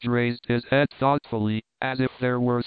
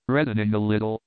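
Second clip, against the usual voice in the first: plain reading voice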